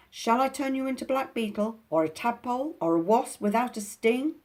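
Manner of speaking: loud, high pixie threat; threatening. Acted. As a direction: make him tremble with threats.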